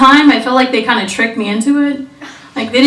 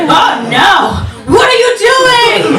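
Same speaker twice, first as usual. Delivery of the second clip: high, louder panicked yell; alarmed shout; 11 semitones higher, 3 dB louder, slower than usual. Retold or imitated. Boyfriend yelping at his date, high panicked shout. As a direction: yelp like he's under attack. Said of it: "and then he's like".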